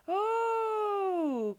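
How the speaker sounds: very high, long, loud "Oh"; cry of surprise. A narrator's reading. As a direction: yelp as he lands in the mud. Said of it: "cried old Tom".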